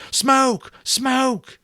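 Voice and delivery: high, loud alarmed shout; urgent shout. A narrator's reading. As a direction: call out the sighting. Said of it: "on his feet and shouting".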